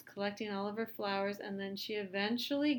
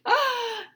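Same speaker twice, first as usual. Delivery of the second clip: high, loud frightened gasp; 14 dB louder than usual. Acted.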